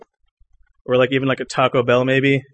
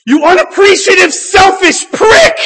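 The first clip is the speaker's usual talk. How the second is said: much higher, louder, very slow bellowed insult; furious shout; 5 dB louder, slower than usual. Acted. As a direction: blow up at a customer who won't stop complaining. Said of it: "Something I find myself saying".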